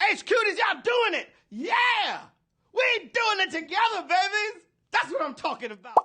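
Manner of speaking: host thrilled by the donations; overjoyed shout. Real, not acted. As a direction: cheer the viewers on, voice cracking.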